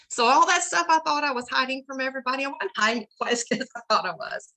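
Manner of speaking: holding back real tears; tearful. Real, not acted. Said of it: "I didn't mean to ugly cry".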